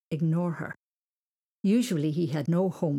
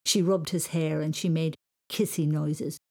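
plain reading voice

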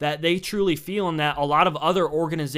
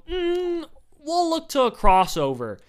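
dismissive boss; 12 semitones higher, slower than usual. Retold or imitated. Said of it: "And they go".

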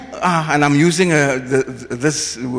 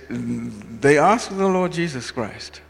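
ordinary talk

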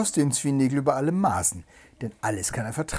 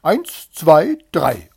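loud starter's count; starting count; 4 semitones higher, 15 dB louder, much slower than usual. A narrator's reading. Hare starting the race, loud count. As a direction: call out each number to start a race. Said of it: "Der Hase zählte".

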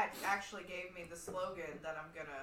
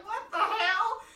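high, loud, laughing disbelief; laughing what the hell; mock disbelief; 11 semitones higher, 9 dB louder, slower than usual. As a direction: blurt it in amused shock. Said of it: "And I'm like".

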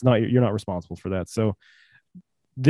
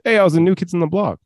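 higher, louder celebrity impersonation; 7 semitones higher, 6 dB louder, faster than usual. Acted. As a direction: call it out loud in a hammed-up celebrity voice. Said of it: "that was my [name] impersonation".

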